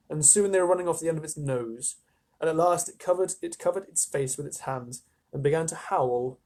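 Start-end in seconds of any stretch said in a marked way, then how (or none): none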